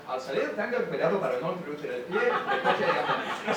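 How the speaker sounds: fast Spanish metro announcer; metro announcement. Retold or imitated. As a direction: rattle it off like the station loudspeaker.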